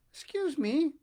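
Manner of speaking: high, polite little voice; polite elephant's child. A narrator's reading. Elephant's child politely asking the crocodile. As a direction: ask very politely, like a small child. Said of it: "most politely".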